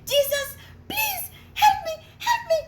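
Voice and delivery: high, loud desperate cry for help; terrified pleading scream; frightened plea. Acted. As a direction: beg for rescue while sinking. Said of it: "he got so frightened and he said".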